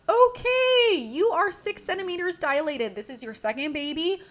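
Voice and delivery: high, bright nurse voice announcing; nurse's brisk update. Retold or imitated. Nurse telling the patient her progress, brisk and high. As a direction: rattle off the news as the nurse.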